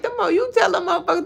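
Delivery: high, fast, laughing through it; a laughing, squeaky retort; laughing quote. Retold or imitated. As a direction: laugh while telling them off. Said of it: "I'm like".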